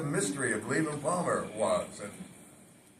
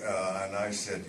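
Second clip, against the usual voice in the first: ordinary talk, nothing marked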